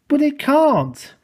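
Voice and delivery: high, slow contradiction; emphatic, high-pitched objection; contradicting. A narrator's reading. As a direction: argue back, stretching the words. Engine contradicts in an argument.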